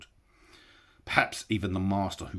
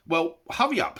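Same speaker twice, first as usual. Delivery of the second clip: louder hobbit urging; Bilbo, impatient; 14 semitones higher, 10 dB louder than usual. A narrator's reading. Bilbo urging Gollum to hurry, impatient. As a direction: hurry him along.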